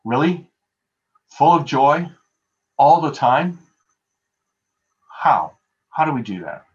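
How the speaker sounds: mock-exasperated questioning, half-joking; talking back to Paul in your head, incredulous; incredulous inner voice. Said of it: "I think to myself".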